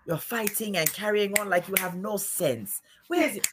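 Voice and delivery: mock African auntie scolding; imitated African auntie accent. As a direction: scold like a strict aunt. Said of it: "be like".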